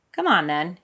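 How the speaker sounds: ordinary delivery, nothing marked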